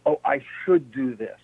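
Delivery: usual speaking voice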